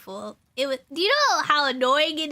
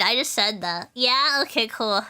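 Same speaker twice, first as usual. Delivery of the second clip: ordinary talk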